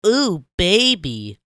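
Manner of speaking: slow, high, loud swoon; swooning SpongeBob. Acted. SpongeBob ogling Squidward, slow high swooning cry. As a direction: drool over the crush out loud. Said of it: "cheeks reddening while he squirmed".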